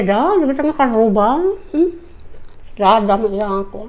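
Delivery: speaking between bites; chewing food while talking. Real, not acted. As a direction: mouth partly full. Seen 0: She eats rice by hand; cheeks puffed, chewing between words.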